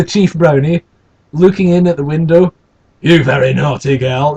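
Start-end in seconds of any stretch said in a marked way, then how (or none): 3.0-4.4 Sean Bean. Retold or imitated. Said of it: "It sounds more like Sean Bean".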